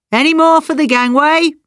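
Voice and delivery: high, loud crewman's call; shouted call. A narrator's reading. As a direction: call out to all the passengers. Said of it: "a voice shouted".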